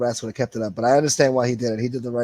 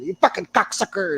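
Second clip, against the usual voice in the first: raised, higher-pitched ranting impersonation; imitated angry insult; 8 semitones higher than usual. Retelling another man's name-calling on the show, mock angry outburst. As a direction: mimic a man hurling abuse. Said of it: "he was calling people cocksucker".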